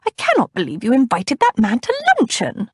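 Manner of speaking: put-on high, indignant older lady voice; huffy older cousin. A narrator's reading. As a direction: huff it out disapprovingly. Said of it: "Cousin Batilda huffed".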